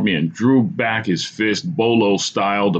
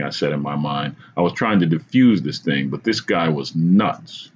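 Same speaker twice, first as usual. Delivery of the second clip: ordinary delivery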